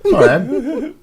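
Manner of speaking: very high mock English accent, amused. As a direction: squeak an English-accented apology, then laugh. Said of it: "had to have an English accent".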